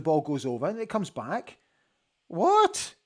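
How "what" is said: high, sharp cry of disbelief; can't believe the call; shocked what. Real, not acted. Fan reacting to the VAR call, shocked disbelief.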